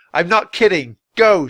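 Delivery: mean it, shout them down; boy warning the wolves with a shout; angry shout. A narrator's reading. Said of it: "shouted Jack".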